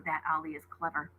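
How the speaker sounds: ordinary delivery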